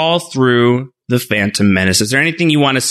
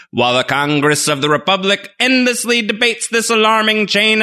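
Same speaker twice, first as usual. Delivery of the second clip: announcer voice; 7 semitones higher than usual. Pretend. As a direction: narrate the crawl like a TV announcer. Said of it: "try and do that here with the opening crawl".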